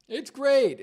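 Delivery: high, loud sarcastic cheer; mock enthusiasm. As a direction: parody someone delighted with himself. Mocking paraphrase of the video maker.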